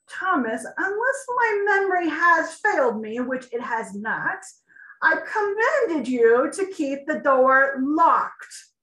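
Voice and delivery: loud, high, slow furious rebuke; angry king scolding. Acted. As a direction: tear into the guard for his failure. King scolds guard Thomas.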